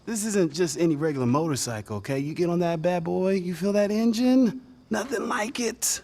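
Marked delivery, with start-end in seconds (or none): 4.9-6.0 slow, hushed, almost whispered; dreamy hush. Acted. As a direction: savour it like a daydream. Dreamy talk about riding a motorbike.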